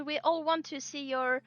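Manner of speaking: normal talking voice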